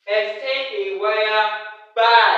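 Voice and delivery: slow reading, "by" punched very loud; stress on "by". Shown as a demonstration. Teacher reads the question to students, punching the keyword "by". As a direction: read it slowly and hit "by" hard.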